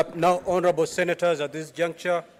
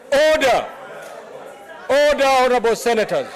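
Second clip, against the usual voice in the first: shouting order order; 6 semitones higher than usual. Real, not acted. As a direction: raise your voice over the chamber. Speaker calling chamber to order.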